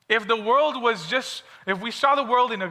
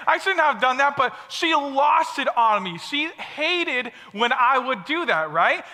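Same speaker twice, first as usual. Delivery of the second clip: normal talking voice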